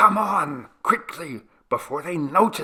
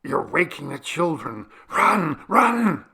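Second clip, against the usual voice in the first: rising to high, loud cries; urgent alarm; 7 semitones higher, 5 dB louder than usual. A narrator's reading. Chabo to companions after the noise, urgent high alarm. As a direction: hiss of panic building to a yell.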